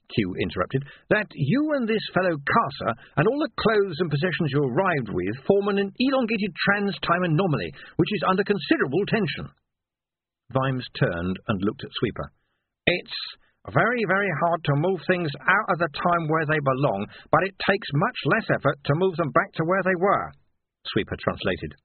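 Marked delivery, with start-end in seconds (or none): 1.1-9.5 high-pitched technical explainer; fussy technician Q. A narrator's reading. Q interrupting to explain the anomaly, high and fussy. Narrator voices Q. 12.9-20.3 higher-pitched, simplifying character voice; patient Sweeper. A narrator's reading. Sweeper translating Q's jargon for Vimes, patient and high.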